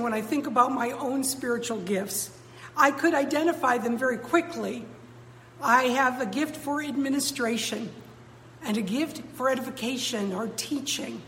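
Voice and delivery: usual speaking voice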